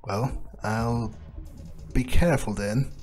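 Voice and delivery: ordinary talk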